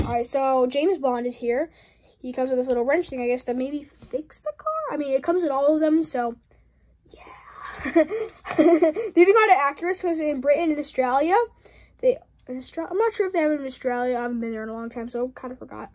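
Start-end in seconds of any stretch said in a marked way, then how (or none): none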